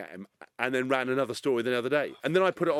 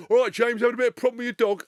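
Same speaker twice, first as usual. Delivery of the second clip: higher, louder, quick chummy jibe; cheeky photographer voice; 10 semitones higher, 7 dB louder, faster than usual. Retold or imitated. Photographer teasing the celebrity about his runaway dog, chummy. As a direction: needle him with false friendliness.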